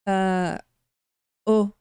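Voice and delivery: ordinary delivery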